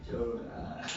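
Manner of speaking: normal talking voice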